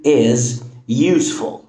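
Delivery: This video is useful, word by word, very slowly; slow example. Shown as a demonstration. Teacher reading the example slowly. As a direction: lay out each word for the learner. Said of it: "أوكي؟".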